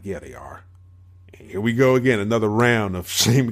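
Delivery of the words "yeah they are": quiet quote. Retold or imitated. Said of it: "I'm like".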